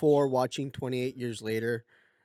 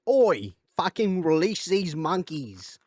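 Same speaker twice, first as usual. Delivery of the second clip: mock British activist; 6 semitones higher, 5 dB louder, slower than usual. Animal activists storming the lab.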